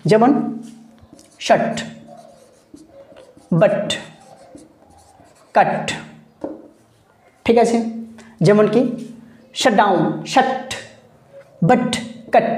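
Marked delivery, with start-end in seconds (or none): 1.5-5.9 শার্ট, বাট, কাট with a very quick "uh" vowel; short আ. Shown as a demonstration. Teacher giving examples of short আ, vowel delivered very quickly. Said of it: "ডেলিভারিটা খুব তাড়াতাড়ি হবে". 9.6-12.5 শাট, বাট, কাট each with a very quick vowel; short আ. Shown as a demonstration. Teacher demonstrating short আ to students, quickly released vowels. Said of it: "খুব তাড়াতাড়ি উচ্চারণ করলাম".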